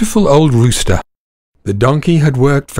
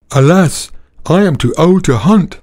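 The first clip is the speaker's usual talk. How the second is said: put-on higher, sorrowful old dog voice; weary old dog; 6 semitones higher than usual. Old dog telling donkey his woes, higher and sorrowful. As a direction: sigh it out like a worn-out hound.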